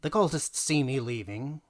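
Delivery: plain reading voice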